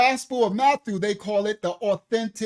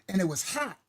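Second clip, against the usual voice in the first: ordinary talk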